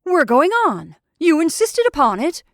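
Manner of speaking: loud, high, insistent prodding; goading. A narrator's reading. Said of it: "began to prod his companion with words".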